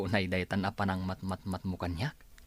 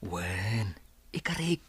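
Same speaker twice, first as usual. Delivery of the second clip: whisper; slower than usual. Acted. Husband quietly promising.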